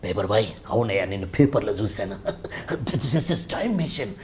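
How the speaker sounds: low, soft film-character dubbing voice; Balasubrahmanyam's dubbing voice. Acted. Mimic showing the interviewer an Aditya 369 character, low dubbed voice. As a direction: put on the old dubbed character voice, low and chuckling. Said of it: "Balasubrahmanyam గారు అప్పట్లో dubbing చెప్పేటోడు".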